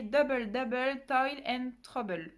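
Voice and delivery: slow, careful stab at an English accent on "Toil and Trouble"; bad English accent. Shown as a demonstration. Booktubeuse reading an English category name, in a bad English accent. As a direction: sound out "Toil and Trouble" in clumsy French-accented English, slowly. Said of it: "J'ai un très mauvais accent anglais".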